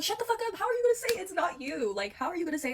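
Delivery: high-pitched, exasperated confrontation; retold indignant outburst. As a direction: cut off the excuses with incredulous protest. Retold quarrel with lying boyfriend.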